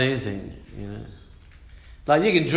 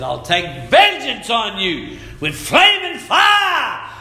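booming shout of divine wrath; thundering God; 7 semitones higher than usual. Acted. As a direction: bellow the Lord's vengeance. Preacher voicing God's threat.

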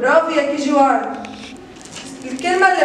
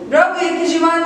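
ordinary talk, nothing marked

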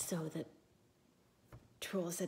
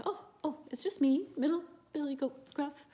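high, louder goat voice; middle goat; 9 semitones higher, 5 dB louder than usual. Acted. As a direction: answer the troll in a squeaky goat voice. Middle goat answers troll.

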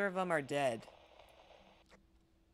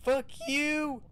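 angry shouting; 8 semitones higher, 4 dB louder than usual. Real, not acted. Escaping ghost, yelling.

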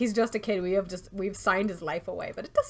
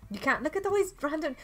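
normal talking voice

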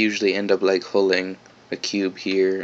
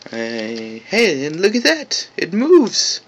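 high, delighted exclamation; thrilled the object moves; excited; 5 semitones higher, slower than usual. Real, not acted. Excited discovery in tutorial.

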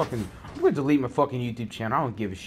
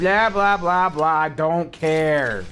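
loud, high blahs, slow drawn-out ending; dismissive mockery; 3 semitones higher, 5 dB louder, much slower than usual. Gamer not caring what's said, loudly dismissive. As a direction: drown them out with jeering blahs. Drowns out the game character.

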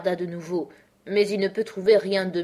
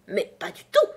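louder Hatter's objection; mild exclamation; 3 semitones higher, 5 dB louder than usual. A narrator's reading. Hatter contradicts Alice, exclaiming. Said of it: "s'exclama le chapelier".

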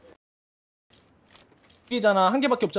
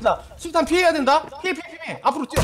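urgent warning; 6 semitones higher, 3 dB louder than usual. Real, not acted. Grenade warning to team.

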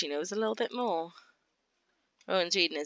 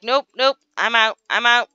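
dad fleeing the awkward talk, loud and hurried; flustered dad voice; 3 semitones higher, 5 dB louder, faster than usual. Acted. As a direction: back away fast, embarrassed. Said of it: "He's like".

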